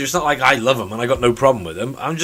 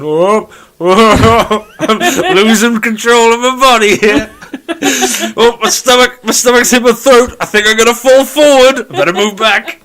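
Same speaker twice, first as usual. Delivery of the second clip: much higher and louder, laughing through it; mock vertigo panic; 9 semitones higher, 9 dB louder than usual. Voicing his own body at a height, laughing mock panic. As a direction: ham up a body losing its balance up high. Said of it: "My body will just start going".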